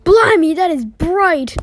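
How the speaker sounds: loud, high startled blimey; dazzled by a sudden light; surprised shout at the glare; startled exclamation. Acted.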